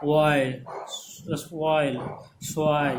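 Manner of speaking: ऑयल, सॉइल stretched on the "oi" vowel; careful teaching model of ऑयल, सॉइल; model pronunciation. Shown as a demonstration. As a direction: draw out the glide in ऑयल and सॉइल. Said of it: "तो इस तरह से हो जाएगा".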